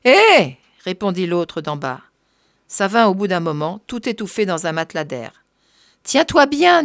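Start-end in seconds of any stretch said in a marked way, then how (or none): 0.0-0.6 high, loud "hey" back; raised, carrying reply; shouted answer. A narrator's reading. 6.0-6.8 high, raised warning call; loud, pitched-up instruction. A narrator's reading. Calls down into the well.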